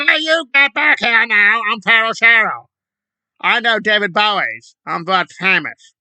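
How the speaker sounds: hammed-up diva demanding attention; mock entitled celebrity. Mocking the protester calling bystanders back, bossy diva voice.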